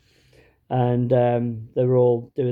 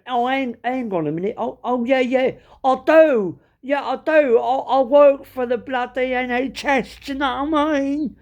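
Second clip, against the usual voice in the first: high-pitched Cockney backtracking; suddenly remember, in exaggerated East End; 11 semitones higher than usual. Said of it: "this guy was proper Cockney".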